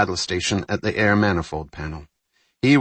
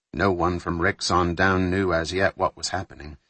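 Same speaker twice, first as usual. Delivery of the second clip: plain reading voice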